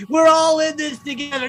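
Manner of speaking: high, loud sneering echo; mocking repeat. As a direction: throw the slogan back in derision.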